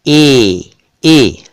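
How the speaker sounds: "ए" said long, mouth barely open; close long "ए". Shown as a demonstration. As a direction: draw out "ए" as a pure close ay sound. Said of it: "ए sound वाले हैं".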